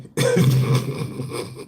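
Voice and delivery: long breathy laughing fit. Real, not acted. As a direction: crack up, mostly without voice. Cracks up at his own bit.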